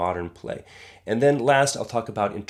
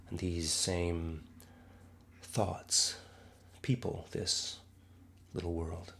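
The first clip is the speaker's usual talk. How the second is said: Shakespearean recitation. Acted. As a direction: recite it as a pensive monologue, sinking to a hush. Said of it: "this speech that I was just reciting".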